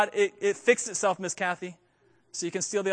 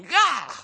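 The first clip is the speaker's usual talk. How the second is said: mock crushing grunt; 6 semitones higher, 5 dB louder than usual. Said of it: "taking two skulls and going".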